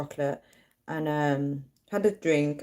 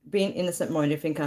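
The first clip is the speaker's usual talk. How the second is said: normal talking voice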